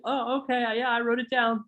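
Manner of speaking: high cooing customer, "no problem, sweetie". Retold or imitated. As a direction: play the polite customer brushing it off. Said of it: "they'll always go like this".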